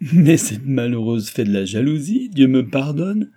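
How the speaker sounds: loud, slow, laughing outcry; bursting into laughter. A narrator's reading. Mistress laughing at her jealous maid, loud slow outburst. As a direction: burst out laughing at the absurd idea. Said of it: "s'écria-t-elle en éclatant de rire".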